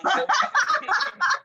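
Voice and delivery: shrieking real laugh, high and loud; cracking up at the answers; high delighted cackle; high burst of laughter. Real, not acted. Reacts to funny answers.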